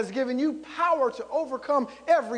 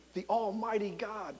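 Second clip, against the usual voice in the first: usual speaking voice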